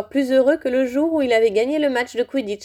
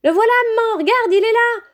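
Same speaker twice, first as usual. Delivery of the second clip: put-on high, loud little girl's voice, bursting with excitement; a small girl spotting someone famous; excited little voice; 13 semitones higher, 4 dB louder, faster than usual. A narrator's reading. Ginny to her mother at the barrier, high excited little voice. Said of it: "dit une petite voix".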